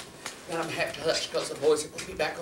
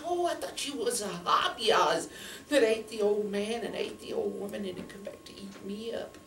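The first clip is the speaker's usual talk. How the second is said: put-on frightened little girl; 7 semitones higher than usual. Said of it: "She said".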